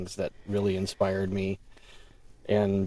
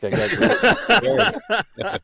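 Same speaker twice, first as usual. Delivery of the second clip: laughing; 13 semitones higher, 7 dB louder than usual. Real, not acted.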